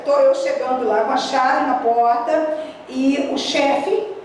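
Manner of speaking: usual speaking voice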